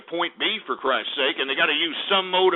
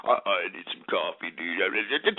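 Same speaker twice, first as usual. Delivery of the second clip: much lower, softer groggy plea; mock whiny coffee craver; 11 semitones lower, 5 dB quieter than usual. Host imitates a coffee addict pleading, low and groggy. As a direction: sound like a zombie who hasn't had coffee. Mimicking someone whining for coffee.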